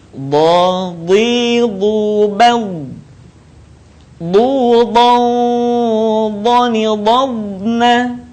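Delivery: Bo, Bi, Bu, Bal chanted slowly on a held note; held, unhurried drill. Shown as a demonstration. Teacher models practice for learners, sustained chanting of Bo, Bi, Bu. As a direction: draw out every syllable from Bo to Na, unrushed. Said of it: "Tahan suara, jangan tergesa-gesa".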